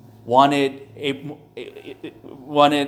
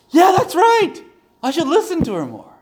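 mock eureka; 13 semitones higher, 4 dB louder than usual. Pastor retells his own reaction.

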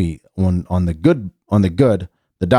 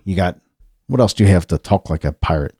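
ordinary talk

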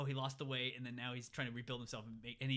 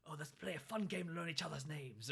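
put-on German accent; 4 semitones higher than usual. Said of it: "Very authoritarian German kind of voice".

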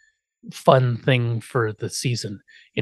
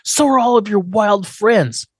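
Lily's accusation; 6 semitones higher, slower than usual. Retold or imitated. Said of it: "She's like".

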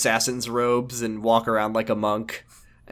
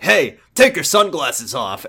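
barked order; 2 semitones higher, 6 dB louder, slower than usual. Acted. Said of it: "is like".

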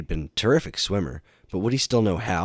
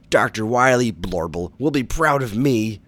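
loud, higher cartoon villain boast; gloating villain; 5 semitones higher, 6 dB louder than usual. A narrator's reading. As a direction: crow proudly over the beaten hero. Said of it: "Bubble Man gloated".

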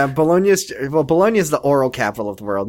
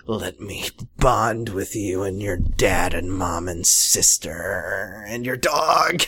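slow, drawn-out mock plea; creepily earnest boyfriend; 5 semitones lower, 3 dB quieter, slower than usual. Boyfriend wants to bond with family, slow creepy plea. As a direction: start low and soft, climb slowly into laughter.

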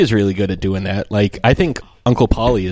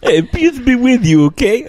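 high goofy impression as a Pope; hammed-up mock blessing in a star's voice; John Travolta; 11 semitones higher than usual. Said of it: "I'm John Travolta".

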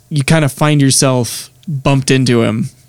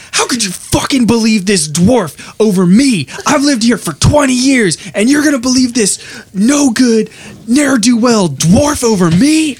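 outraged foreman; 9 semitones higher than usual. Acted. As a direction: rage at the guard's betrayal. Outraged foreman accuses guard.

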